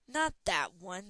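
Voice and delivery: loud slow snap; snapped. Acted. Dragon snaps at Talon's mistake, loud. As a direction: bite off each word. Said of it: "the dragon snapped".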